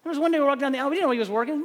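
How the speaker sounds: ordinary delivery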